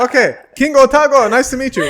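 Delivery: high, loud playful greeting; comically over-loud hello; mock royal greeting. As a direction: hail an imaginary king, hammed up. Greeting imagined King Otago.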